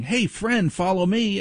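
put-on cheerful child beckoning friends; kid's friendly call. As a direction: sing it out like a cheery kid. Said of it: "they see her saying".

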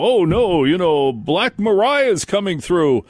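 over-the-top alarmed radio announcer; hysterical weatherman parody. Retold or imitated. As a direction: ham up a terrified storm warning. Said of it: "we didn't go".

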